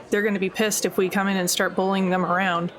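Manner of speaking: usual speaking voice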